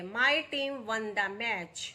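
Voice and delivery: very slow, every word laid out for students; slow dictation read. Shown as a demonstration. Teacher reading the example to her students, slow and deliberate. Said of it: "teacher reads example sentence".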